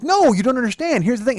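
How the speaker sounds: high-pitched, insistent protest; intense arguing. Retold or imitated. Wound up talking to his partner, intense retold protest. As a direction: jump in high and argue hard. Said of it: "I'll get kinda intense".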